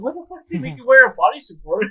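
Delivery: mock disbelief. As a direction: rattle it off in stunned disbelief. Retelling his own disbelief.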